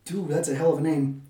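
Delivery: normal talking voice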